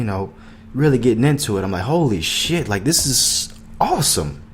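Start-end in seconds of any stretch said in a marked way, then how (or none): 1.8-4.4 excited discovery. Retold or imitated.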